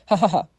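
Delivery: loud open laugh of greeting; hearty laugh. Acted. Director welcoming Xiaoqi, hearty laugh.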